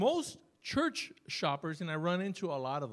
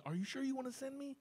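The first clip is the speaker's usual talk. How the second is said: higher, softer and fast, a nervous inner question; scared; 10 semitones higher, 6 dB quieter, faster than usual. Retold or imitated. Preacher voicing his own scared thought about being sent. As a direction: let the fear slip out fast and high. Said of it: "scared now".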